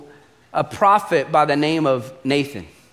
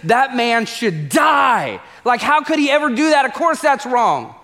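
livid outburst, higher pitched, rising to a drawn-out shout on die; enraged; 7 semitones higher than usual. Retold or imitated. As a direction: play an outraged king's indignation. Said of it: "David is enraged. Like, he is livid".

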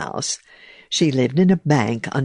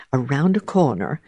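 plain reading voice

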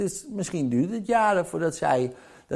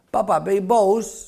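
higher, a lightly voiced little kid; child asking; 6 semitones higher, 4 dB louder than usual. The kids asking their father, lightly voiced child. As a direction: ask it like a small child would. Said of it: "zijn kinderen zeggen".

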